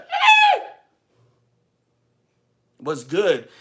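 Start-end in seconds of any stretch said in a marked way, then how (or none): 0.0-0.6 high piercing battle cry; ninja scream. Shown as a demonstration. Streamer showing his ninja-style fight scream, shrill. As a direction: shriek like a ninja attacking. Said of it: "it always a ninja".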